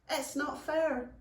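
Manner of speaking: put-on high, slow childish protest; little goblin Stain. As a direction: draw it out like a sulking little goblin. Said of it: "said Stain".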